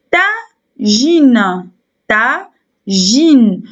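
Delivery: "Tagine" slowly, as ta, pause, gine; split into syllables. Shown as a demonstration. Teacher showing pupils two syllables, "Tagine" split as ta, gine. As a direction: break "Tagine" into two drawn-out beats. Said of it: "Le mot tagine contient deux".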